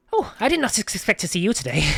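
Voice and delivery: put-on old gnome startled by a visitor. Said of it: "he says".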